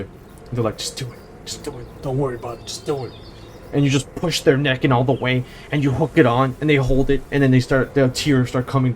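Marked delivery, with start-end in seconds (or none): 0.8-3.1 soft, much quieter reassurance, as if murmured to a hurting buddy; hushed urging. Retold or imitated. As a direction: keep it quiet and coaxing, barely voiced. Buddy urging during painful uniform hooking.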